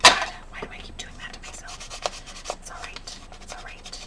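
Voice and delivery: fast whisper then soothing; whispered self-scold. Real, not acted. Crafter whispering at herself after the wrong color, hushed. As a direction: scold yourself under breath.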